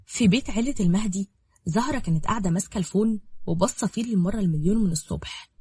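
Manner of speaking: ordinary delivery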